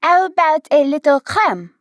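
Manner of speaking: French crème. A narrator's reading. Waitress offering cream in fake French. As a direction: savour crème in a put-on French accent. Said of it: "pronounce it the way it's pronounced in crème brûlée".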